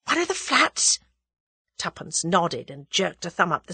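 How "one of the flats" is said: high, breathless eager question. A narrator's reading. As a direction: gasp it out in wonder. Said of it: "demanded breathlessly".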